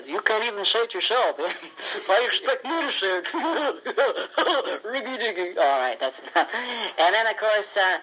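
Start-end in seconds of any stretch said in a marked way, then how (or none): 0.1-5.6 high cheeky puppet voice, laughing; a dummy talking back; Horatio dummy voice. Acted. Horatio mocks the ventriloquist in a high voice.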